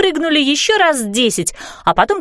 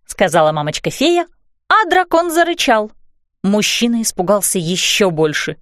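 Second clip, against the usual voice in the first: ordinary delivery, nothing marked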